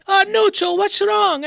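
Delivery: high, loud imitation of a worried wife; wife's alarm. Wife woken by her husband's scream, imitated alarm. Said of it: "and she's like".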